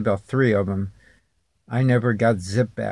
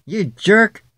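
higher, louder talk-show put-down; Mark Levin; 10 semitones higher, 6 dB louder than usual. Retold or imitated. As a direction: snap it at the caller like a radio host. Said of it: "I wish I could talk like him when he gets down there and go".

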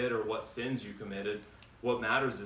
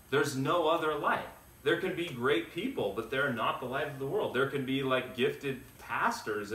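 fervent preaching; 4 semitones higher than usual. Acted. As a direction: preach it with rising fervour to the congregation. Preaching builds to full force.

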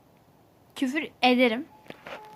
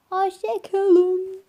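sing-song goodbye; 6 semitones higher, 5 dB louder, much slower than usual. Real, not acted. Signing off the video.

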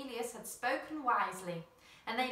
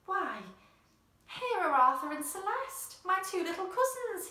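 high, loud amazed cry; cried in amazement; 10 semitones higher, 6 dB louder than usual. A narrator's reading. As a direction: greet them with joyful surprise. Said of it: "he cried in amazement".

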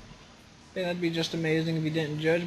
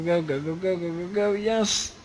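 ordinary talk

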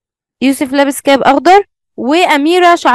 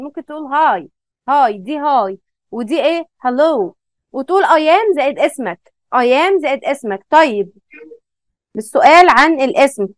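normal talking voice

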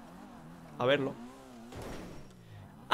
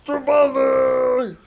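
high, very loud drawn-out yell; furious shout; 8 semitones higher, 11 dB louder than usual. Real, not acted. Gamer cursing after a mishap, voice-straining shout. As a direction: scream it out at the game. Yells insult at the game.

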